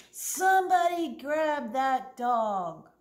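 loud, high and slow scream; manager screams. Acted. Manager yells for staff to catch the dog, screaming. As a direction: shout it out to every employee. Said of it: "The manager screamed".

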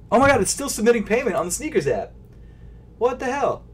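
high, fast exasperated rush; frustrated high exclaim. Real, not acted. Vlogger stuck on payment screen, high fast exasperation. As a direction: pitch up in disbelief.

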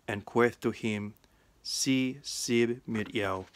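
put-on West Saxon Old English reading, steady. Shown as a demonstration. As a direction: read the Gospel in Old English, 'queth' said as kweth. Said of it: "The reading is from the Gospel of John in West Saxon".

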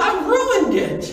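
high, slow lament; drawn-out, high-pitched retold distress; distraught girl. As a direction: wail it in acted despair. Distraught girl blames herself.

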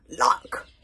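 loud, scornful burst; roared. A narrator's reading. Baggy Bum scoffing at praise for the boy, roared. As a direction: roar the word out. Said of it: "roared Baggy Bum".

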